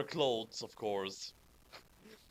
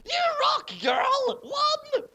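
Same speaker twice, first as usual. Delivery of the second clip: much higher, louder shrieked cheer; squealing girl; 4 dB louder, much slower than usual. Acted. Cheering on Willow, shrill squealing teen girl. Shrieking fangirl quote in fanfic.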